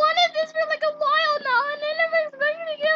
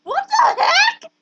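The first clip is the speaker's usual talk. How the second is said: a sudden laughing yell, higher and louder; excited laughing shout; 5 semitones higher, 9 dB louder than usual. Real, not acted. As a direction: burst out laughing in disbelief. Surprise at a rare game drop.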